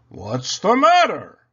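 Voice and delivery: loud, jolly question; merrily. A narrator's reading. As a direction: tease him cheerfully. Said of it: "Santa said merrily".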